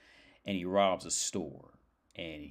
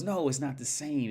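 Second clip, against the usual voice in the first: lightly voiced robber objecting, higher; mild acted protest; 8 semitones higher, 3 dB louder than usual. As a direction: object in a slightly hammed-up higher voice. Robber objects to guide's offer.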